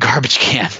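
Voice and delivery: ordinary talk, nothing marked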